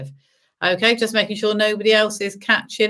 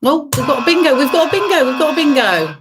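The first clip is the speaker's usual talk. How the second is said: excited; 6 semitones higher, 4 dB louder than usual. Acted. Announcing a winner, music.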